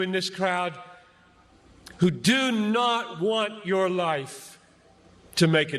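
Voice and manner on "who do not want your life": ordinary talk, nothing marked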